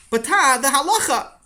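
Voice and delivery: put-on high child voice, protesting; a kid pleading with his father; whiny child protest.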